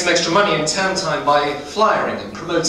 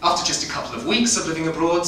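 normal talking voice

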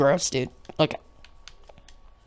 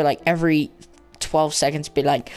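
ordinary talk, nothing marked

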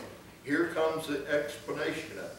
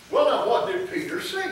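ordinary delivery

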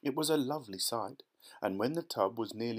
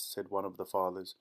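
ordinary delivery, nothing marked

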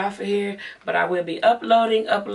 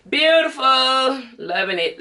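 gushing delight; 6 semitones higher than usual. Real, not acted. Vlogger gushing to viewers over makeup, delighted. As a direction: draw it out high and pleased.

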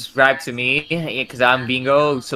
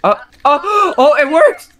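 high, loud delighted gasp; surprised gasp; 11 semitones higher, 5 dB louder, slower than usual. Real, not acted. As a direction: gasp as the door finally opens. Door finally opens in game.

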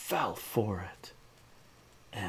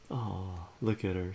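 ordinary delivery

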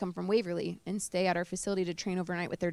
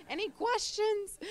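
much higher, slow, laughing through the words; 13 semitones higher, 4 dB quieter, slower than usual. Real, not acted. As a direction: giggle out the question to break the emotion. Laughing off tears before moving on.

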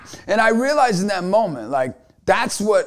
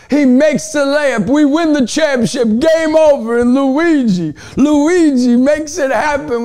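louder and higher, building to a triumphant yell; excited shout; 8 semitones higher, 6 dB louder than usual. Real, not acted. Coach telling viewers about the championship win, excited shout building. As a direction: call the winning shot like it's happening now. Retelling the winning play.

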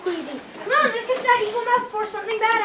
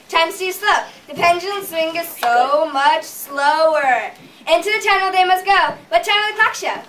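sing-song rhyming storyteller voice; rhyming storybook narration. Acted. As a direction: chant the rhyme like a storybook.

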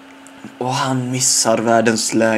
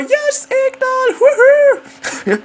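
jubilant shout; 4 dB louder, much slower than usual. Retold or imitated. Celebrating his first assist.

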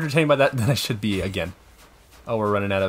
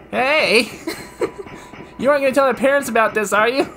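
put-on high, loud, eager kid; excited kid voice; 13 semitones higher, 6 dB louder than usual. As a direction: squeal like a fan kid. Voicing game character.

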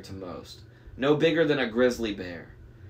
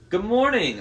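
glazed greeting; 10 semitones higher, 5 dB louder than usual. Acted. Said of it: "his eyes glazed".

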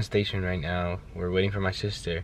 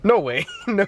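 laughing, louder and higher; laughing disbelief; 10 semitones higher, 5 dB louder than usual. Real, not acted. Laughing at failing to start the car with her feet. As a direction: crack up at failing.

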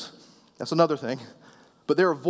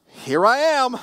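put-on higher, louder drawn-out teenage reply; teenager; 7 semitones higher, 5 dB louder, slower than usual. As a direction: answer like an exasperated teen, stretched out. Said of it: "he's probably a teenager".

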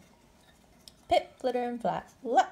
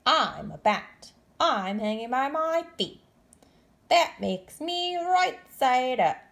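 slow, loud, firm bat voice; a bat stating facts; insistent bat; 5 semitones higher, 7 dB louder, slower than usual. Acted. Bat insisting to Stellaluna, loud and slow. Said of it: "Creature said".

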